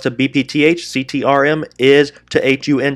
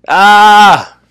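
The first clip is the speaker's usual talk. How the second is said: a sudden high, loud cry of frustration; frustrated yell; 9 semitones higher, 11 dB louder than usual. Real, not acted. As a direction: blurt out a sharp yell at the chart. Frustrated trader sees stock reverse.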